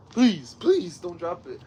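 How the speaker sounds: urgent plea, first words high and loud; real pleading, punching the first words. Real, not acted. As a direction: beg them anxiously not to drop it. Pleading with the dog not to drop.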